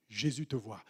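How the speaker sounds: low, soft reassurance; quiet tender reply; gentle reply. Acted. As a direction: answer softly, like a loving refrain. Preacher's repeated answer.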